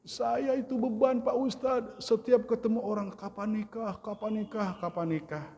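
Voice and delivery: high-pitched plaintive mock lament; imitated tearful complaint. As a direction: play a weepy woman unburdening herself to the ustadz. (tiruan keluhan sambil menangis, bernada tinggi)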